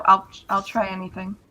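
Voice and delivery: ordinary talk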